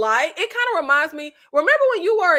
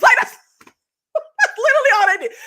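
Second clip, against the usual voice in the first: higher, louder, laughing through it; laughing disbelief; 9 semitones higher, 5 dB louder than usual. Real, not acted. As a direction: crack up at how silly it was. Laughing at the duck fight.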